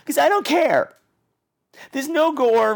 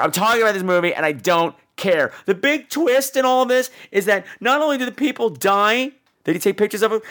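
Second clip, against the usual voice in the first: ordinary delivery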